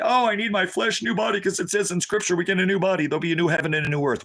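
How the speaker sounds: high mocking whine of the flesh-lovers; mock whiny believer. Mocking the churchgoers in a high whine. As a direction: sneer at them in a high voice.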